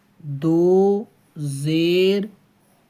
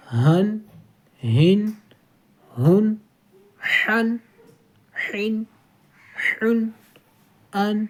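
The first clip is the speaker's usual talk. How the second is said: slow measured syllable chant, one beat each; qaida recitation drill; 2 semitones higher, much slower than usual. Shown as a demonstration. Teacher recites the tanween drill. (قاعدہ کی تلاوت کا انداز)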